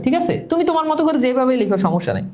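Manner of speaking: ordinary talk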